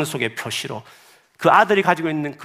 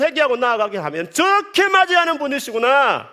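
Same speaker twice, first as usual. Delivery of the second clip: high, raised, exultant preaching; fervent preaching; 7 dB louder than usual. Acted. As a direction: proclaim God's welcome with fervor. Preaching at full force. (높고 힘찬 목소리로 감격에 차서 설교하듯)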